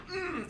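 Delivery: high, tight, quiet fuming hum; imitated fuming grunt. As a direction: mime pent-up rage with a strained mm. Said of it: "the papa bear is fuming".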